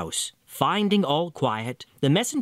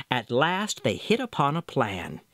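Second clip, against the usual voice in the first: ordinary delivery, nothing marked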